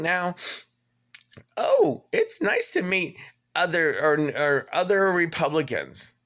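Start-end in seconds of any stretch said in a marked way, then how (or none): 1.6-6.1 high, sing-song mocking "Oh, it's nice"; mock reviewer. Quoting the Paradise Shores review in a high mocking voice. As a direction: parody the chirpy reviewer. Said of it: "a Google review I found that says".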